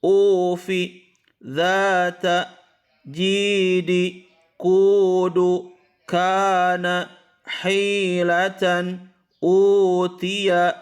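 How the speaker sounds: slow, high chanted reading of Arabic words; a teacher chanting a tajwid example list; slow Quran recitation. Shown as a demonstration. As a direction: recite each word with long vowels drawn out. Teacher recites the full word list.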